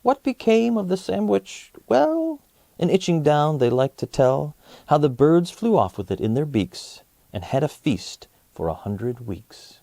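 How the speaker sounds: ordinary delivery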